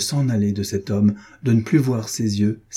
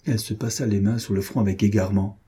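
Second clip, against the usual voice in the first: ordinary delivery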